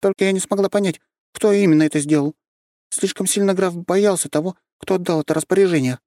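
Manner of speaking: lightly voiced young girl; high girl voice. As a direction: speak up high like a small girl. Girl voice continues.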